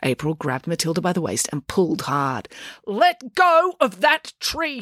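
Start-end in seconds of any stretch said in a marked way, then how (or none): 2.9-4.8 slow, high, effortful order; drawn-out exasperated command; straining demand. A narrator's reading. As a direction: demand it while pulling hard. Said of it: "she demanded".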